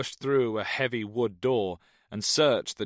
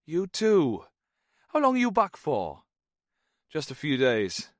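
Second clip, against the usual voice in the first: high, loud Welsh chatter; lilting friendly Welshman; Welsh accent; 7 semitones higher, 4 dB louder than usual. A narrator's reading. Jones asks Snow about his visit in a Welsh accent. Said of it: "The Welshman's accent".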